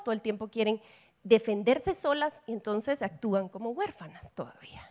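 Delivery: hushed whisper. Real, not acted. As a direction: drop to a whisper for emphasis. Susurro en el sermón.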